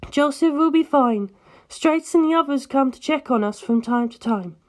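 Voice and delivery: put-on a light, worried older-sister tone; Erina's voice. Erina reassures Penelope.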